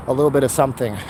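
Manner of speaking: normal talking voice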